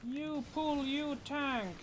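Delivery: high squawky parrot chatter. Pretend. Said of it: "I'm doing a parrot voice acting now".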